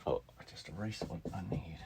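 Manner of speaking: fast whispered aside. Real, not acted. Teacher whispering after erasing her notes. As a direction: whisper it quickly in dismay.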